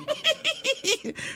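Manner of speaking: short, very high titter; soft high-pitched giggle at your own joke. Real, not acted. Laughs at her own bluff.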